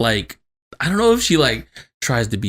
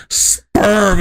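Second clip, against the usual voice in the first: raised, loud, stretched-out word; blunt punchline; 8 semitones higher, 5 dB louder than usual. Retold or imitated. Son repeats mom's only answer, loud and blunt. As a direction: land it like a comic bombshell.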